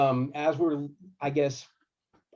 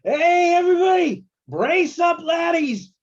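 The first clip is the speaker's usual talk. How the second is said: high, loud imitated warning shout; bus driver's yell; 8 dB louder, slower than usual. Bus driver warning sleeping passengers as the bus coasts, imitated yell. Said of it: "hear our bus driver yell like".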